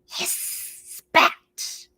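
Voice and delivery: voiceless hiss and spit; cat hiss. Acted. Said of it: "she arched her royal fur".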